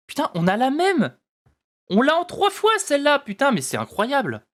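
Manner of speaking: excited outburst. Real, not acted. Pulls a duplicate card.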